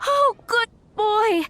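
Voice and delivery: delighted cooing. Acted. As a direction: gush over him like a beloved pet. Owner greets her cat.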